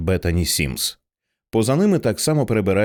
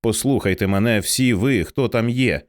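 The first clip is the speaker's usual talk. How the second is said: ordinary delivery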